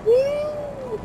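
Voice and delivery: very high woo; squeal. Real, not acted. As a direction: whoop with glee. Jet stunt.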